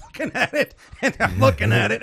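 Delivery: high voice breaking into laughter; amused retelling. Real, not acted. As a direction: tell it through rising laughter. Amused retelling of embarrassing moment.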